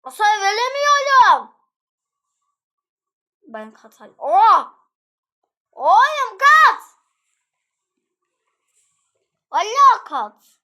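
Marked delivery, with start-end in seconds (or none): 0.0-1.6 high, very loud wailing complaint; frustrated wail. Real, not acted. Player cannot deal any damage, frustrated wail. 4.2-10.6 high, very loud cries to flee; panicked yell. Real, not acted. Player calls to flee from danger, panicked yell.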